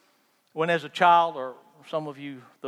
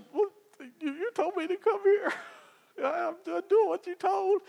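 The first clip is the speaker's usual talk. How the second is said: much higher, laughing protest to God; mock protest. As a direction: squeak out an indignant excuse while laughing. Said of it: "I'm like".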